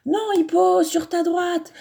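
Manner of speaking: high, loud playground shouting. A narrator's reading. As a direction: yell across the field to push him. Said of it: "se mettent à crier".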